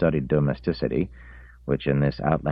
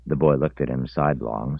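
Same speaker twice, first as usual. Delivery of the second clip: ordinary delivery, nothing marked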